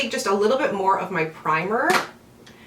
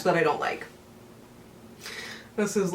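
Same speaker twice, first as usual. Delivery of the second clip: usual speaking voice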